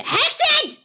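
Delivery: startled high shriek; sudden shriek. Real, not acted. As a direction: yelp it out in shock. Sudden loud shriek in game.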